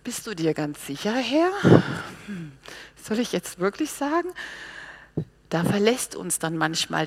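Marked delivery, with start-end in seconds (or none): 0.0-4.3 hesitant doubting. Acted. Believer questions God's order, hesitant and doubtful. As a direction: question the order timidly, with pauses. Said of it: "da würde ich auch denken".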